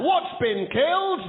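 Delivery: slow, loud, high call-out; rally the crowd with the call; shouted call. Shown as a demonstration. Host leading the audience chant, shouting it slowly. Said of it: "Let's just try that".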